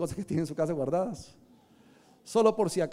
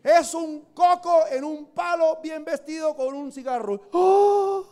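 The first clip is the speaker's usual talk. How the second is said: high, slow mocking description; mocking idol; 9 semitones higher, 4 dB louder, slower than usual. Preacher mocking the Mashimón idol in a high, slow voice. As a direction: ham up the ridicule of the idol, pitched up.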